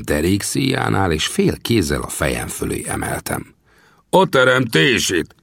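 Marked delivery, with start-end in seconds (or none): 4.1-5.3 high, drawn-out curse of alarm; startled cry. A narrator's reading. Gunsmith hoisted overhead by his belt, crying out. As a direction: cry out in indignant shock. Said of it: "kiáltotta lábával kalimpálva".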